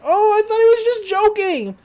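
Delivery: loud, high-pitched goofy excuse; high cartoon protest. Handyman excusing himself for missing the warning, high mock protest. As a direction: push it up high, mock defensive.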